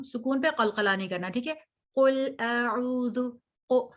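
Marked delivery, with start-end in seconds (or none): 1.9-3.2 قل اعوذ slowly, with a bold ق and a soft ل without bounce; teacher corrects the student's opening, slowly; heavy qaf, soft lam. Shown as a demonstration. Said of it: "قاف بول، لام کو نرمی سے".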